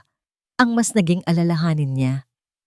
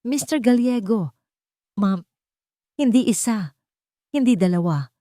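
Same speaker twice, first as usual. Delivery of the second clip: excited doctor; 4 semitones higher than usual. A narrator's reading. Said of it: "nanginginig sa pagkasabik".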